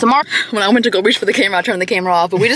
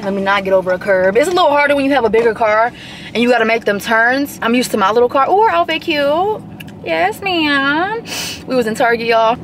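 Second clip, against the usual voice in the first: usual speaking voice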